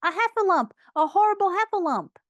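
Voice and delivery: high, quick panicked cries; small creature squealing in alarm; Piglet crying out. A narrator's reading. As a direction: raise the alarm in a high, frightened voice. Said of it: "cried Piglet".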